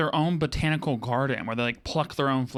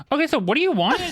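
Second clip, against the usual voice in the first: high, loud fed-up retort; exasperated; 11 semitones higher, 6 dB louder than usual. Retold or imitated. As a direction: snap back, fed up and baffled. Exasperated reply to creepy client.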